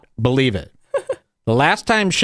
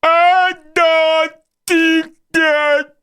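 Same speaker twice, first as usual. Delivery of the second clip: much higher, very slow, strained put-on voice; high, louder, very slow strained impersonation; Michael Lehrer impression; 4 dB louder, much slower than usual. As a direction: drag each word out painfully, mocking him. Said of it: "this is exactly how he sounds".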